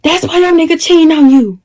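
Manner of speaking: high-pitched mocking taunt; imitating the rival. Shown as a demonstration. Teller demonstrates how the girl taunted her, mocking. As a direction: act out her jibe in a high put-on voice. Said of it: "This is her, right?".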